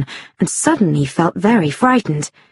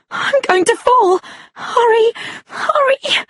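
very high, frightened urging; panicked. Acted. Woman about to fall from a ledge, panicked. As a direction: plead in panic as if slipping.

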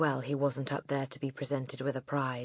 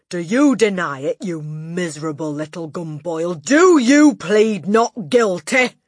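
furious interrogation; 3 semitones higher, 12 dB louder than usual. Acted. Headmistress interrogating the boy. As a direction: grill him like a prosecutor.

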